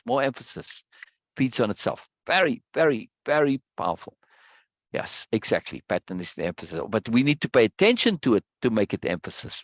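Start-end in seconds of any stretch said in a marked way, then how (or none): none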